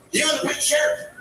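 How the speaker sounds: high shouted commands; barking demands. Retold or imitated. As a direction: play the deranged man ordering. Homeless man giving orders.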